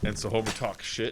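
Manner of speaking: ordinary delivery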